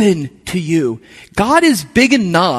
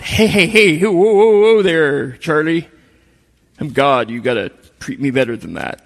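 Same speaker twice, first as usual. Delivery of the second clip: mock stern God; 2 semitones lower than usual. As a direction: playfully voice God scolding Charlie. God voiced as scolding figure.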